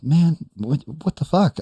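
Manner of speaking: high-pitched, baffled; incredulous, acted; retold disbelief. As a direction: act out stunned bafflement in a high voice. Retelling his baffled reaction.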